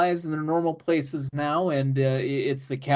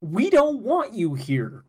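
rude brush-off; 6 semitones higher, slower than usual. Retold or imitated. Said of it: "And they each go, like".

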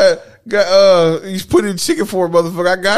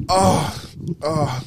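ordinary talk, nothing marked

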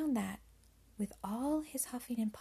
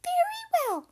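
very high, loud squeaky voice; agreeable little pig; 12 dB louder than usual. A narrator's reading. Pig agrees to the wolf's plan, high squeaky voice. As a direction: pipe up as a tiny piglet. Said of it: "said the little pig".

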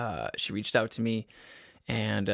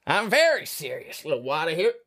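silly put-on voice; 5 semitones higher, 3 dB louder than usual. Joke serious then silly voice.

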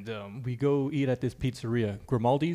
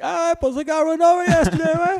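very high, loud caricature of a buddy; Javi impression; 9 dB louder than usual. Buddy retelling his accident at the gym, high loud impression. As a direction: blare it out in your friend's high voice. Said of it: "this dude actually talks like this".

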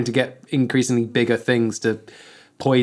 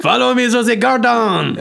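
robot spider lure; 10 semitones higher, 4 dB louder than usual. Pretend.

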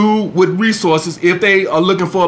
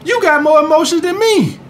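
mimicking her, pitched way higher; high mocking impression of a woman; mock woman's voice; 10 semitones higher than usual. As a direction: quote her in a high put-on voice. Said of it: "what she's saying is".

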